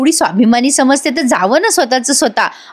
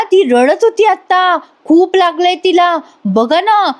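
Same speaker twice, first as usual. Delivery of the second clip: higher, pleading with fake urgency; overdone insistence; 7 semitones higher than usual. Husband convinces grandpa she is hurt, higher fake urgency. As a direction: oversell her injury to grandpa. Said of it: "अद्वैत म्हणतो".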